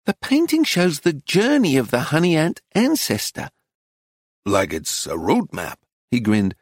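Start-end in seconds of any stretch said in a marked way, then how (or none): 0.1-3.5 higher-pitched dealer; woman dealer's patient higher voice; explaining shopkeeper. Acted. 4.4-5.8 low-pitched pondering tourist; husband's lower character voice; American man. Acted. American man offers his idea.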